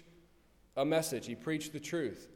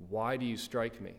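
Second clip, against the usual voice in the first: lower, even reading voice; measured scripture reading; 4 semitones lower than usual. A narrator's reading. Preacher reading Jesus' answer to the officer, measured scripture reading. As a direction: read the question soberly and evenly.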